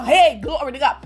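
ordinary talk, nothing marked

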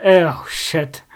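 usual speaking voice

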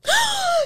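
a high, startled gasp; sharp gasp. Real, not acted. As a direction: suck in air in shock. Reacting to a clear ghost voice.

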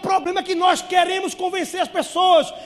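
high, loud emphatic declaration; shouted sermon conviction; preaching shout. Real, not acted. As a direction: drive the point home from the pulpit. Preacher stresses key point.

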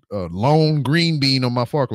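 ordinary delivery

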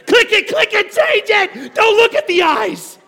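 laughing mock panic at a puppy ad; panicked shout. Panicked yelling at TV ad.

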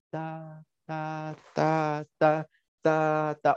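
even, monotone beat syllables, starting very soft and building; mental rhythm practice. Shown as a demonstration. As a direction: count out the clock's beat on one note. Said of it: "con base en este tiempo y digo". (ensayando el ritmo con sílabas)

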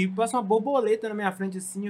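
ordinary talk, nothing marked

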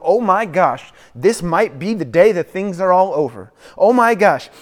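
loud, high, anxious rush of questions; a parent watching the news, frantic; panicked worrier. Retold or imitated. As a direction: spiral into frantic worry. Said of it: "put on the news and go".

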